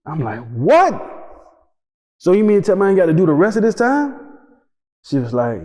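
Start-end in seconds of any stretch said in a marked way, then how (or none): 0.5-4.2 shocked. Retold or imitated. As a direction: blurt it out stunned. Hearing he got clemency.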